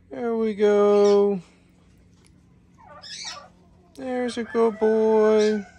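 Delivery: high, slow sing-song praise. Real, not acted. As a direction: coo praise to a pet. Praising pig softly.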